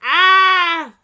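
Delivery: loud burst of glee; loud joyful shout. Real, not acted. Player overjoyed by the date, loud shout. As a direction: shout for joy.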